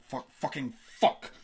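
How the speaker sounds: loud, slow, drawn-out swearing; a frustrated shout at himself; panicked outburst. A narrator's reading. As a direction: let the panic explode.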